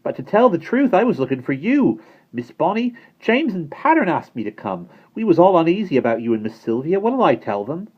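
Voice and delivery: put-on high, loud and cheeky boy's voice; cheerful boy. Simon explaining himself to Bonnie, cheerful and cheeky. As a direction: toss it off with a wink.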